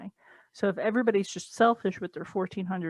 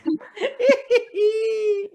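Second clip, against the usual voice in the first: shrieking burst of laughter; cackling loudly; loud high laugh; 7 dB louder than usual. Real, not acted. Laughing at a stimulation joke.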